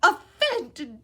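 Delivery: high, slow, choked elf cry. A narrator's reading. Elf choking up at Harry, high and slow. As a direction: choke it out through tears. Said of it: "choked the elf".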